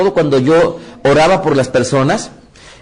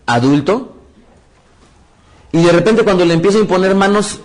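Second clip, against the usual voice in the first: normal talking voice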